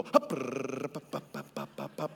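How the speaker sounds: lip-flapping sputter falling into low pops; mock sputtering. Preacher mocking people waiting for God to move their tongue, comic sputtering. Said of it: "take their tongue and go".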